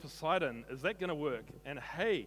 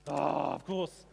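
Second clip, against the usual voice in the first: ordinary talk, nothing marked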